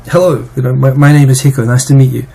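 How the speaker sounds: casual native-sounding English self-introduction; cool fluent English. Shown as a demonstration. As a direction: introduce yourself in smooth, natural-flowing English. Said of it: "かっこよく言う".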